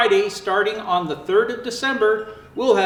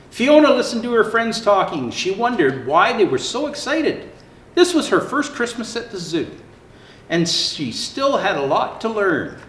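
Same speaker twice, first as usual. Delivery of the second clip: lively storybook narration; reading to children; 3 semitones higher than usual. Acted. Santa reading a zoo story to children, lively. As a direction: tell it brightly for little ones. Reads Fiona story aloud.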